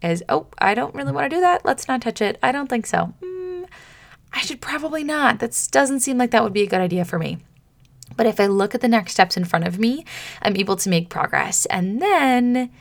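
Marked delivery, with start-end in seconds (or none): 0.3-7.4 timid reluctance. Retold or imitated. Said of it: "That is my reaction to fear".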